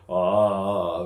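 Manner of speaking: low, sustained vowel; low demonstration ah. Shown as a demonstration. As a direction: hold a low ah briefly. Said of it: "a little bit".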